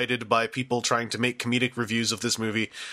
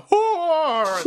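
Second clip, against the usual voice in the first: put-on Irish accent; 14 semitones higher, 5 dB louder than usual. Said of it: "in an Irish accent".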